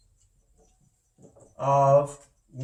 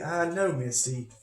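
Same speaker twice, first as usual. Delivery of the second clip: ordinary delivery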